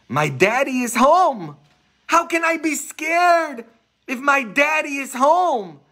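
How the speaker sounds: put-on high, loud confident child; little boy's voice. Priest voicing the little boy unafraid in the storm, high child voice. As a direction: sound like a sure six-year-old.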